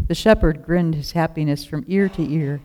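ordinary delivery

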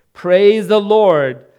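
high, loud, slow call to God; loud prayer. A narrator's reading. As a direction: pray it out at full voice. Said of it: "stood on her porch and prayed".

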